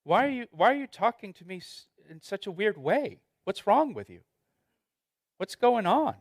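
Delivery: baffled, high-pitched what-are-you-doing reaction; incredulous. Pretend. Spouse baffled by a weird way of talking, incredulous and high. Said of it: "they would say to you".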